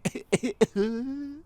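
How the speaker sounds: high whooping wheeze of dry heaving; whooping cough noise. Retold or imitated. Telling viewers how he sounded heaving, high whooping cough imitation. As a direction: mimic your own retching whoop over the toilet. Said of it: "that's how I sounded in that moment".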